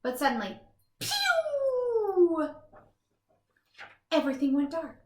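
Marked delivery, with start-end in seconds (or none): 1.0-2.5 sound effect pew. Acted. Power cuts out.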